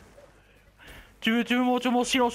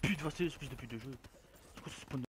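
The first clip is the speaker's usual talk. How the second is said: grumbling; 7 semitones lower, 13 dB quieter, much faster than usual. Real, not acted. Grumbling at the spawn.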